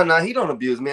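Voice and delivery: normal talking voice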